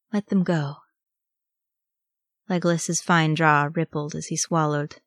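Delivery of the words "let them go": usual speaking voice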